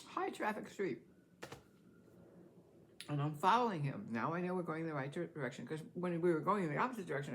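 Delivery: ordinary talk, nothing marked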